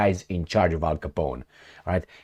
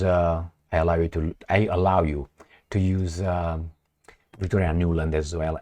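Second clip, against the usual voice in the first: ordinary delivery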